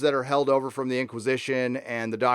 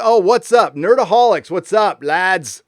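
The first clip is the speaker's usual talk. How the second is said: excited shout-out; 5 semitones higher, 7 dB louder than usual. Real, not acted. Excitedly greeting the Nerdaholics.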